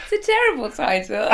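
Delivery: laughing while talking fast. Real, not acted. Laughing with an old colleague about the episode title. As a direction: giggle your way through the words.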